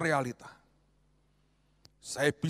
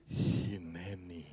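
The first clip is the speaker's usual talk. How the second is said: slow, low, drawn-out hineni; sanctimonious dodge; smug pious dodge; 9 semitones lower, 9 dB quieter, much slower than usual. Retold or imitated. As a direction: hide stinginess behind holy words. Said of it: "Jijik itu caranya".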